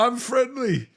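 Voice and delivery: lightly voiced cat saying hello; voicing the cat. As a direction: speak for the shy cat, cutesy. Interviewer speaks for the cat.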